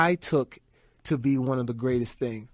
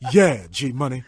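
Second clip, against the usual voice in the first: speaks through a chuckle; laughing Wesley; 2 semitones higher, 6 dB louder than usual. Retold or imitated. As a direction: laugh into the words. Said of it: "Wesley always laugh before he say".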